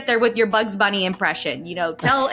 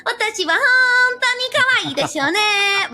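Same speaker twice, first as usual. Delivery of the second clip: put-on high cutesy anime girl, in Japanese; the same cute girl, now in Japanese; 12 semitones higher than usual. Shown as a demonstration. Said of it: "you can go".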